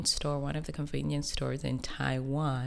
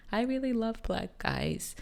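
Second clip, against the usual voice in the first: imitating a stranger; 8 semitones higher than usual. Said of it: "the next thing you're like".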